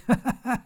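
high cackling laugh; mocking laugh. Demonic trucker Sharon laughing at Goldie, high cackle. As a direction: laugh at him with menace. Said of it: "Sharon laughed at him".

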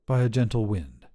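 usual speaking voice

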